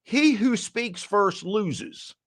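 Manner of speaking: drawn-out, knowing delivery of the rule; slow, deliberate sales wisdom; slow sales maxim. Shown as a demonstration. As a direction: spell it out slowly like a sales mantra. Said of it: "you've been in sales".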